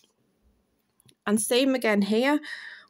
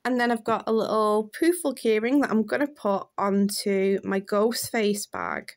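usual speaking voice

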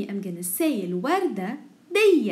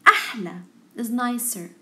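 ordinary talk, nothing marked

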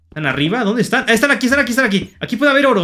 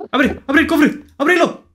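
eager urging; 7 semitones higher than usual. Real, not acted. Urging the chest open.

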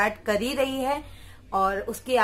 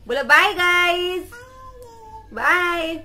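high, loud drawn-out goodbye; loud sing-song bye; 6 semitones higher, 5 dB louder, slower than usual. Real, not acted. Mom calls bye loudly for her toddler to copy.

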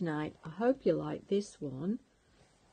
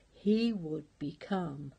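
usual speaking voice

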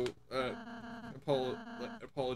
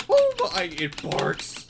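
delighted surprise; 10 dB louder, much slower than usual. Real, not acted. Player sees a car bark, squealing high with delight.

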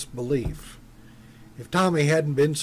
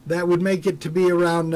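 ordinary delivery, nothing marked

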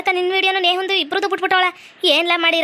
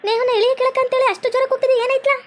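concerned neighbour; 8 semitones higher than usual. Acted. Neighbour calls out, asking what happened.

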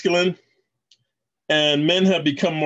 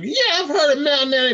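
high-pitched mock troll voice; 12 semitones higher than usual. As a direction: mimic an internet troll in a much higher voice. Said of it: "some trolls who".

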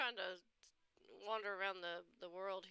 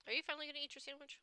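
teasing prod; 7 semitones higher, faster than usual. Real, not acted. Prodding someone to eat.

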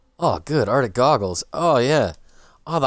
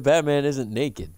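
usual speaking voice

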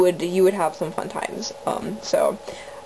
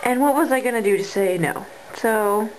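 ordinary talk, nothing marked

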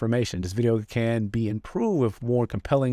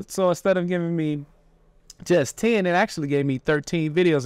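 yelling over helicopter; 6 semitones higher than usual. Real, not acted. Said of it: "Excuse the helicopter".

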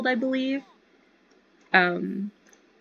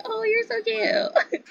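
cooing at babies; slower than usual. Real, not acted. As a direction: gush over the babies like a doting aunt.

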